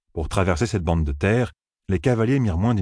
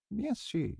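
much softer, higher knowing murmur; 13 dB quieter than usual. A narrator's reading. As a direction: breathe it out, barely audible. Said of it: "murmura Vérine".

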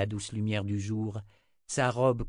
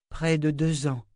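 higher woman's voice, falling end; sombre answer; 7 semitones higher, 5 dB louder than usual. A narrator's reading. Seer tells the wizard how long, lightly voiced. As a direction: answer with a firm downward close.

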